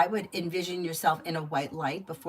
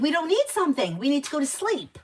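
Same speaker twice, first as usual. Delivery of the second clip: raised, higher-pitched firm refusal; stern parental scolding; 8 semitones higher, 4 dB louder than usual. Real, not acted. Parent telling a child to go to sleep, stern. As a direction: shut the excuse down firmly, pitched up.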